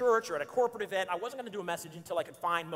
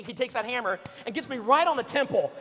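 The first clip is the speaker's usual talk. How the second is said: ordinary talk, nothing marked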